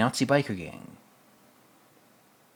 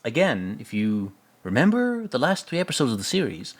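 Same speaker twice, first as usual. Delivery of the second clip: ordinary talk, nothing marked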